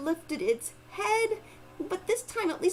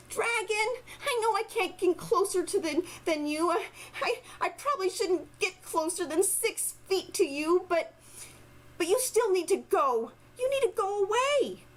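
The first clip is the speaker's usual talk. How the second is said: put-on little girl voice; 7 semitones higher than usual. Girl pleading with dragon.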